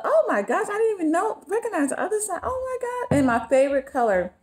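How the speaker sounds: high squeal of real delight; excited surprise. Real, not acted. Vlogger discovering the other side of a gift, excited squeal. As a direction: squeal it, pitch leaping up.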